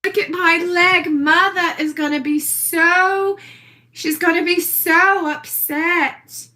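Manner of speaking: put-on East Hampton socialite accent; Little Edie. Showing off her leg on video, in an affected East Hampton accent. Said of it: "back to this little Edie thing".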